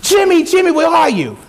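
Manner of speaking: father shouting for his son. Retold or imitated. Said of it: "he yells a little bit louder".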